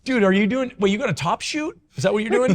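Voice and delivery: high, incredulous ribbing of a teammate; mock teasing. Clubhouse teammates ribbing him about full uniform, high mocking disbelief.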